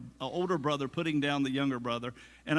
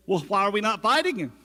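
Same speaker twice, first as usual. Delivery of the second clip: fast, loud, a bit higher eagerness; keen young David, louder and quicker; eager; 4 semitones higher, 6 dB louder, faster than usual. Retold or imitated. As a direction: push it like a keen kid itching to fight.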